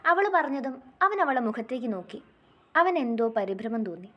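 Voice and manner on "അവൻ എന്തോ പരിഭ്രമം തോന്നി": ordinary delivery, nothing marked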